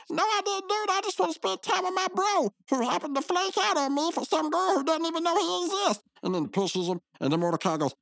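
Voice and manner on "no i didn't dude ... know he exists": much higher, whiny cartoon voice; squeaky retort. Rigby blaming Mordecai for ditching him, high and whiny. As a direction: whine back like a jilted buddy.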